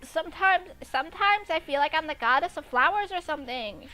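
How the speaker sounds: Amy musing. Acted. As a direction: lift the voice up as a girl musing to herself. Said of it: "Amy said to herself".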